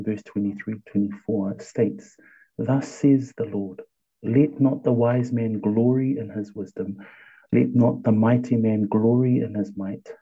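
ordinary delivery

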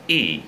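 Y as a crisp, sustained "ee"; long sharp i. Shown as a demonstration. Teacher shows how the sound must be, long sharp i on Y. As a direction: stretch Y into a tense "ee" with a smile. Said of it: "nó phải sắc, nó phải dài".

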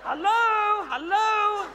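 high, loud sing-song hello up to the gallery; loud teasing hello called up high; calling up high. Acted. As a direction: call up to the balcony, hamming it.

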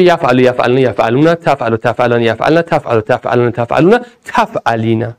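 student answering. Retold or imitated. Voicing a student reciting present forms, lightly. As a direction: murmur the present forms like a pupil. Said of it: "مضارعش چیه".

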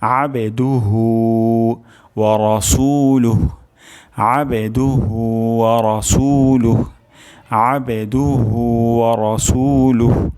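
আবে with ain from the throat, রাসূলুহ slowly with a fall; recitation drill. Shown as a demonstration. Teacher drills students on the correct recitation. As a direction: recite আবে দুহু ওয়া রাসূলুহ slow and measured. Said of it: "আইন উচ্চারণ হবে".

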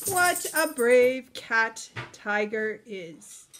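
ordinary delivery

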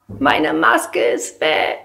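slow, breathless little whisper; whispered child's lament. Acted. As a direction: whisper it like a scared kid. Child wails mask lost. (geflüstertes, gedehntes Kind)

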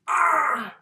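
high, loud startled scream. Real, not acted. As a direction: scream in sudden fright. Sudden fright at the game.

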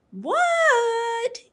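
high loud answering yell; yelling back. A narrator's reading. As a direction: shout back from out in the snow. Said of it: "yelled Froggy".

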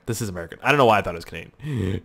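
usual speaking voice

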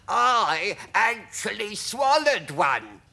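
slow, high, loud proud declaration; drawn-out creature brag; proud earthworm. A narrator's reading. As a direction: boast it slowly as an earthworm. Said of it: "the earthworm declared proudly".

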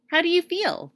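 put-on high young girl, simply said; a little girl speaking simply. A narrator's reading. Dorothy asks Scarecrow.

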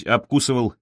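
ordinary delivery

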